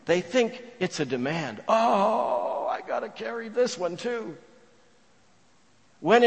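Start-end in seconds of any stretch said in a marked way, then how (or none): 1.7-4.4 high, drawn-out weary complaint; mock burdened groan. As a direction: moan it like one more load to carry.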